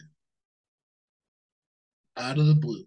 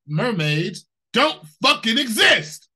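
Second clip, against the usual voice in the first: louder, slow, scornful punchline; exasperated shout; 7 semitones higher, 6 dB louder, slower than usual. Real, not acted. Answering the science video, exasperated shout. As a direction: hammer each word home.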